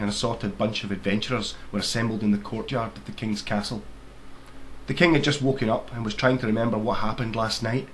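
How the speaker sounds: usual speaking voice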